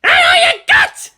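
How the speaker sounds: extremely high, loud, slow roaring scream; battle scream. Acted. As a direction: unleash it with raging fury. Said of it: "Asuka screamed into open comms".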